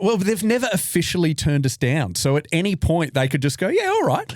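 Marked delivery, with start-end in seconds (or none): 3.7-4.3 lifted high and easygoing; high casual Kiwi voice. Retold or imitated. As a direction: toss it off high and carefree. New Zealand casually accepting.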